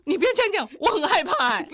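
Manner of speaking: high, loud, alarmed laughing plea; mock scared husband. Husband startled by wife's compliment, high laughing alarm. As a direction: ham up his startled fear. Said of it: "惊到".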